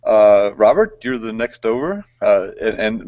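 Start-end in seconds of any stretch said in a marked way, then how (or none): none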